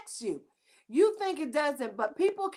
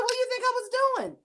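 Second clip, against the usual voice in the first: fast, much higher, incredulous retort; exasperated retort; 11 semitones higher, much faster than usual. Retold or imitated. Host retelling her retort to her girlfriend, fast and high. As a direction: toss it back, half laughing. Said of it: "I said".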